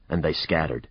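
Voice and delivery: usual speaking voice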